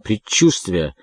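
plain reading voice

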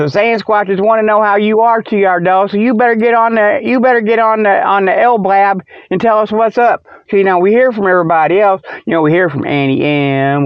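normal talking voice

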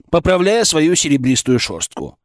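usual speaking voice

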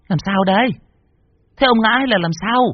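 high wife's voice, anxious question; the wife calling from the porch, worried. Acted. Wife asks what happened. (giọng bà vợ cao, lo lắng)

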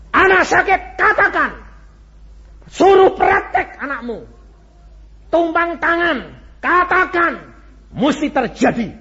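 loud, high testimony cry; preaching at full force. Acted. Preacher proclaiming his own practice to the congregation, full force.

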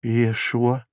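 soft, prompt reply; hurried answer. A narrator's reading. Prisoner giving his name to procurator, hurried. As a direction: give the name at once, softly. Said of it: "поспешно ответил арестант".

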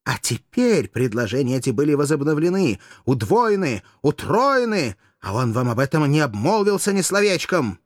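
heated, emphatic revelation; mounting accusation. A narrator's reading. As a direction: build the charge step by step. Courtesan builds up her accusation.

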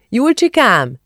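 high calling shout up the stairs. A narrator's reading. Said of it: "kiáltotta Szidi néni".

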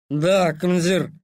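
put-on Да slow, vowels slurred drunkenly; drunk slurring. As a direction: drunk soldier barely saying vowels. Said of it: "не выговаривая толком гласные".

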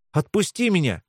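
usual speaking voice

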